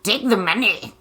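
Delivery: hissing, pushy villain mother; wicked stepmother. Retold or imitated. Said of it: "she was very, like".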